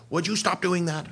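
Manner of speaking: a hint of a scolding mum; nagging parent. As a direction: parents nagging a teen, lightly voiced. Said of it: "all you're gonna be hearing from us is".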